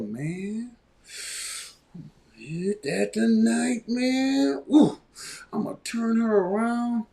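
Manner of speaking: lightly put-on voice, slow, savoring drawl; mock lustful man. Host mimics a guy seeing his woman, mock lustful. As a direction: draw it out like an aroused admirer.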